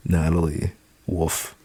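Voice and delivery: ordinary delivery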